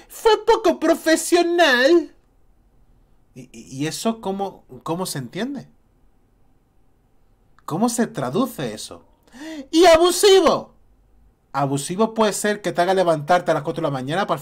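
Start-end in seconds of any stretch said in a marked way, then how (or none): 0.1-2.1 high, slow mocking voice; mock quote. Vlogger mocking the vague accusation, high slow sneer. 9.7-10.7 high, louder mocking voice; mock quote. Vlogger mocking the vague accusation, high loud sneer.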